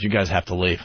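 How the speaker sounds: low, stern order; stern guard. Retold or imitated. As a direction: tell them flatly to go. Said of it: "comes up to us and goes".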